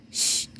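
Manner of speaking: unvoiced "shh" for silence; a soft hush to the listeners; shushing. Hush acted in the story.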